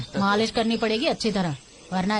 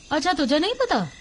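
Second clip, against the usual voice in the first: higher, questioning throw-back of his words; sceptical echo; 5 semitones higher than usual. Acted. Woman questions the stranger's denial, sceptical echo. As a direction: echo him as if unconvinced.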